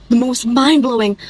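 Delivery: usual speaking voice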